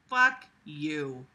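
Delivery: ordinary delivery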